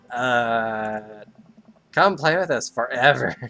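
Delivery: eerie twins in unison; sing-song creepy child voice. Acted.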